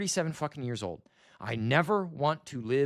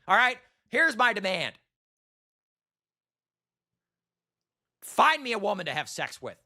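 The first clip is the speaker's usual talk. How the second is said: loud demand; 5 semitones higher, 3 dB louder than usual. Real, not acted. Shouted demand.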